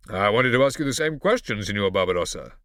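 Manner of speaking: haughty, loud imitation of a father; condescending Dottore Massimo. A narrator's reading. Scipio imitating his father to Barbarossa, condescending. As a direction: look down on him loudly. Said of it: "imitation of his father's condescending tone".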